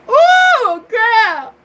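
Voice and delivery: loud, very high swooning squeal; excited whoop. Real, not acted. Telling women about finding a real man, excited whoop.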